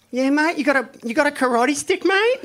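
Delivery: squeaky, cocky Aussie challenge; high-pitched Australian "mate?" taunt; Aki impression. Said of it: "he gets up, he's like".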